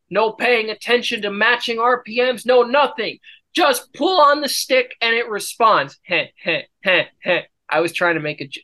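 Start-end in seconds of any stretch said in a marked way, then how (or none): 6.0-7.4 evil chuckle. Real, not acted. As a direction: chuckle darkly under breath. Laughs at own failed joke.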